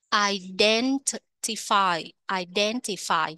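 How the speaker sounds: "Identify" as i-DEN-ti-fy, beat on DEN; second-syllable stress. Shown as a demonstration. Teacher modelling the word for learners, stress on DEN in "Identify". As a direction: lean on DEN in "Identify", syllables clearly parted. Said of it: "nhấn âm hai".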